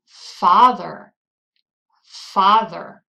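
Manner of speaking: "Father" with a loud, strong first syllable; stress on first syllable. Shown as a demonstration. Teacher quizzing learners on stressed syllable, loud first syllable in "Father". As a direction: punch the "Fa" in "Father". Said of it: "it's the first syllable".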